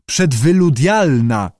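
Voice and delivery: slow sounding-out of "przedwyludialna"; careful fumbling attempt. A narrator's reading. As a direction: try the hard word slowly, syllable by syllable. Fred fumbles a difficult word.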